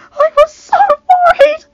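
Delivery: high, loud sobbing cry. A narrator's reading. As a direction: sob it out in relief. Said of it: "Marjorie sobbed".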